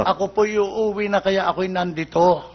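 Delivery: slow, polite explanation; acted drunk humbly reassuring the cop; meek and well-behaved. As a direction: drag it out gently, all courtesy.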